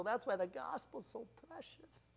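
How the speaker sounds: hushed, breathy confidence; a reverent whisper. Real, not acted. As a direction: lean in and whisper it. Confiding aside on the gospel.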